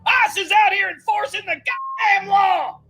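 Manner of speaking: angry drill instructor bawling out recruits; drill sergeant rant. Acted. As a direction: chew them out like a boot camp instructor.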